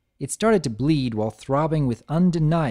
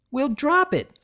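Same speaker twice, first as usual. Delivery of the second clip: shout; 13 semitones higher than usual. Acted. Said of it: "Applebloom shouted".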